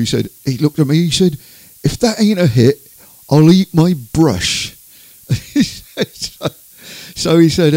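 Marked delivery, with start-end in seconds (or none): 1.8-4.8 loud, higher imitation of a country builder; the singer quoted. Singer boasting to a roofer about his record, mock declaration. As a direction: punch it out slowly as a punchline. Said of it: "this is what Reg told me".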